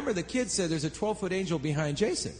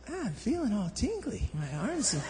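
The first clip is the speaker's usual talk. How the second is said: high, swooping tipsy voice; mock woozy wonder; imitating drunk friend; 3 semitones higher, 4 dB quieter than usual. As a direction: slide around dreamily as if plastered. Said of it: "He was completely plastered".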